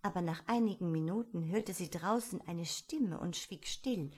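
ordinary delivery, nothing marked

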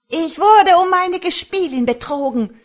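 high, very loud furious wail; screams of rage. A narrator's reading. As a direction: scream your outrage through the walls. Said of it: "Die Wutschreie der Muhme hallten".

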